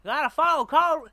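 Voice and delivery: high, loud, drawn-up cartoon character voice; Jimbo. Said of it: "But Jimbo doesn't have a Southern accent".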